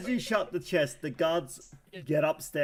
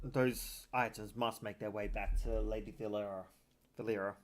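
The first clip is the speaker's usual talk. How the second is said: soft, lower guard's mutter; muttering under breath; 5 semitones lower, 7 dB quieter than usual. Acted. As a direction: keep it low and to yourself. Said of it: "He starts muttering under his breath".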